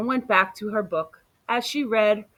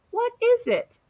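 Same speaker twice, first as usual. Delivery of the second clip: put-on much higher child voice asking; little boy; 13 semitones higher than usual. Little boy asking Amu about the smell, high child voice. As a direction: ask like an eager small boy.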